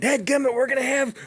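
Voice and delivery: high, fast, fussy griping; imitated rant. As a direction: rattle off a worked-up hunter's complaint. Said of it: "he just immediately goes into a rant".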